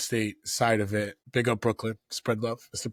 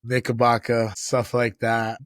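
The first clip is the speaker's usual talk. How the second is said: usual speaking voice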